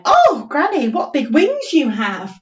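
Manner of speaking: high squeal on oh, granny; squirrel voice. Acted. Teacher voicing squirrel admiring Granny's wings. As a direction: gasp it like an amazed squirrel. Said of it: "the squirrel said".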